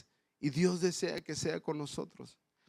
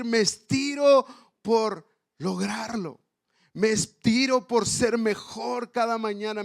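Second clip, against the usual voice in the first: proclamado fuerte, subiendo el tono; predicación intensa; 5 semitones higher, 6 dB louder than usual. Acted. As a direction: predica con fuerza hacia la meta. Predicación a pleno. (predicación apasionada y enfática)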